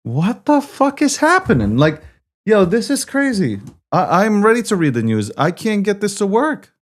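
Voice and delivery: loud, high-pitched frantic complaint; exasperated outburst. Real, not acted. Streamer ready to read the news can't get it working, exasperated outburst. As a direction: cry out in real frustration, pitch jumping way up. Stream breaking, host cannot fix it.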